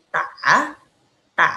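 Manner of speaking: "ta" dipping low, then back up; low dipping "ta", unaspirated; third tone. Shown as a demonstration. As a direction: sink low on "ta", break, then lift it. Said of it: "D A nada tiga".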